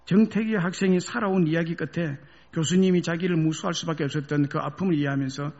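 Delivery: ordinary talk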